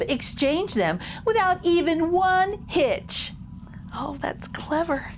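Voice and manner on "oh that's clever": ordinary delivery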